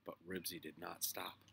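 usual speaking voice

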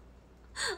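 high breathy mock sob; a short pretend wail; fake baby cry. Streamer pretending to cry over the lag, mock sob. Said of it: "赤ちゃん帰りしちゃう".

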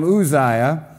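Uzziah as "oo-ZYE-uh"; scholar's pronunciation. Shown as a demonstration. Preacher adopting the scholar's way, Uzziah pronounced "oo-ZYE-uh". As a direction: open Uzziah on a pure "oo". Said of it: "go with the scholar's pronunciation".

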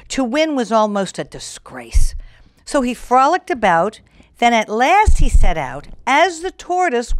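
lilting rhyming storybook read; story narration. A narrator's reading. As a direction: read the verse to children. Reads rhyming fable aloud.

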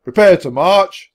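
loud, high, slow parade command; centurion's shout. A narrator's reading. Centurion to the parade line, shouting. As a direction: call it out down the line. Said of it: "the centurion shouted".

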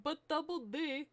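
lightly voiced cartoon character. Shown as a demonstration. Trying out a cartoon voice on a catchphrase, lightly done. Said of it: "Should I make him sound like Ed from Ed, Edd n Eddy".

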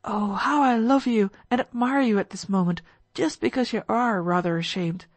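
plain reading voice

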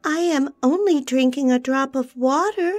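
high, small frightened bird voice. A narrator's reading. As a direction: plead timidly, afraid of her anger. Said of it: "quite frightened at seeing her so angry".

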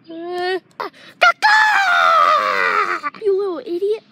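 high pained shriek turning angry; scream, then snap at it; screaming at a spider. Acted. Bitten again, screams.

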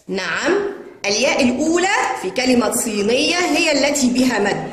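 ordinary delivery

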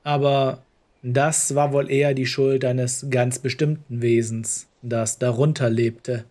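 usual speaking voice

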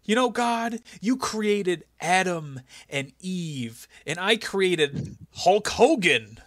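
mock sermon to God; imitating Vince. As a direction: preach it slowly like a boss comparing himself to God. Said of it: "He's like".

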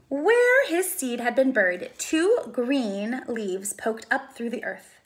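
ordinary delivery

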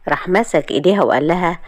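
plain reading voice

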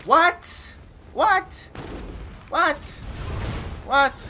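high, loud, rising "What?" again and again; repeated disbelief. Real, not acted. Gamer yelps over and over after dying. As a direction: keep yelping in disbelief.

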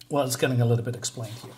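normal talking voice

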